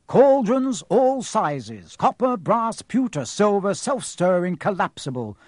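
high, loud, slow showy advert; shop sign read out. Acted. Sign over the cauldrons, read as showy advert. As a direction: announce it like a shop crier.

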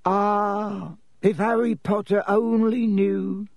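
high, drawn-out lament; woeful little house elf; groaning elf. A narrator's reading. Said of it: "Dobby groaned".

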